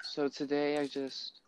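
slow, soft boring drone; monotone voice. Retold or imitated. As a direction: drone it like a dull podcaster. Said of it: "like, straight monotone voice".

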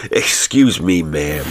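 amused American frontiersman, lightly voiced; jovial American drawl; politely amused, in American dialect; Elias P. Hutcheson. A narrator's reading. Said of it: "He laughed out jovially".

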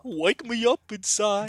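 high, slow cartoon singing. Host singing Bring Me to Life, high cartoon voice. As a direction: imitate a dopey cartoon belting a lyric. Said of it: "as Goofy".

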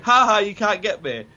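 mocking singsong from up a tree; taunting. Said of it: "And he's like".

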